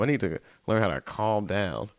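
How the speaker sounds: usual speaking voice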